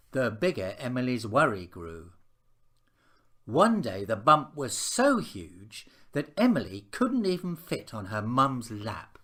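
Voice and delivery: ordinary delivery, nothing marked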